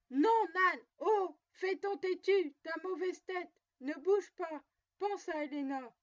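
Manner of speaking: loud, high-pitched commands; urgent shouting. A narrator's reading. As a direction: shout at a stubborn donkey to stop it. Anna shouts orders at her donkey.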